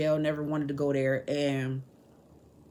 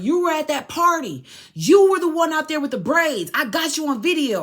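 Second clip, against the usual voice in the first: imitating shouting cop; 10 semitones higher, 10 dB louder than usual. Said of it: "He was like".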